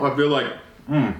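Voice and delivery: talking while chewing; words around a mouthful; mouth full. Real, not acted. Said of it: "I'm eating with my mouth full".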